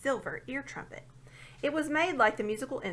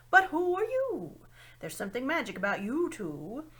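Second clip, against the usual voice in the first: a big owl marvelling, louder at the end; 5 dB louder than usual. A narrator's reading. Owl questions the children.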